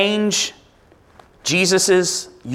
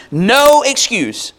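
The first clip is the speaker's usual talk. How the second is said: slow, higher, leaned-on; slow emphasis; 4 semitones higher, slower than usual. Acted. Preacher leans on no excuse, slow and high. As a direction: drag out no excuse. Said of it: "you ain't got no".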